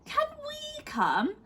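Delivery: put-on high, slow little boy asking; child voice. A narrator's reading. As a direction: pipe up like a small hopeful child. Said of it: "asked Lester".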